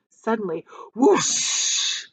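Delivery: plain reading voice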